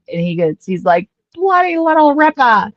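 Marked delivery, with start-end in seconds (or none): 1.3-2.7 high, hammed-up Perth Aussie; Perth accent. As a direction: imitate a Perth bloke, pitched way up. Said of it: "he's from Perth 'cause of his accent".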